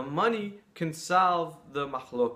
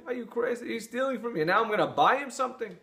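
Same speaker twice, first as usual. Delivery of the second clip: higher, outraged objection; mock outrage at the idea; indignant protest; 9 semitones higher, 4 dB louder than usual. Man protests rabbi's advice.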